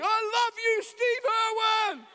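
high, loud, drawn-out fan cry; adoring shout. Retold or imitated. Starstruck passenger standing up in the plane, adoring shout. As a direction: holler devotion, stretching the name.

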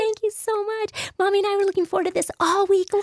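imitating a delighted child, very high-pitched; high, thrilled little-kid voice; excited little girl. Little girl thanks the baby shower host, excited. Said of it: "her little girl was just excited".

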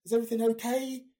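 high, loud, anxious Is everything okay?; worried loud question. Retold or imitated. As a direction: call out in alarm. Worried question after landing.

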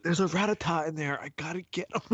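hammed-up urgent gamer; Pokemon gamer character. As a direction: act out spotting a must-catch creature. Acts a gamer hunting Pokemon in minefield.

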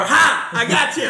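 high, loud gloating; loud, high, gloating taunt; mock gotcha. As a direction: crow it like a victory. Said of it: "She opens the door".

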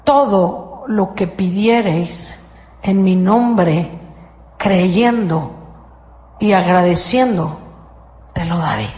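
slow, measured scripture reading; solemn scripture quote. Shown as a demonstration. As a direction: intone it slowly like holy writ. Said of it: "Fíjate cómo funciona".

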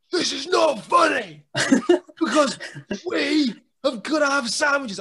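high loud shout cracking into laughter; laughing mock fury. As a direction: rage but crack up mid-line. Sam yelling while boys laugh.

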